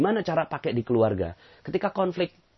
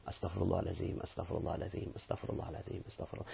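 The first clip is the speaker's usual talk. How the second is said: softer and softer, sinking into a whisper; fading whispered istighfar; 4 semitones lower, 15 dB quieter, faster than usual. Shown as a demonstration. Preacher shows the congregation how to murmur istighfar. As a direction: let the prayer die away to a breath. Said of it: "Maka pengucapan istighfar menjadi benar".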